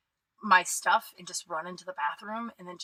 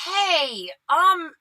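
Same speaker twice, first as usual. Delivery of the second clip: awkward greeting; 8 semitones higher, 7 dB louder than usual. Retold or imitated. Awkward reply to unwanted greeting.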